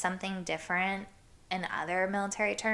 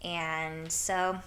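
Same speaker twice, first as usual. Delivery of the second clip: normal talking voice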